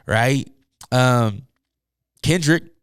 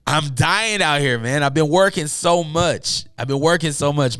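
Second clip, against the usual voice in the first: normal talking voice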